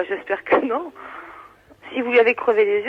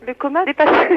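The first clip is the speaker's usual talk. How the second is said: normal talking voice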